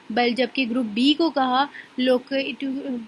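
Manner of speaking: ordinary delivery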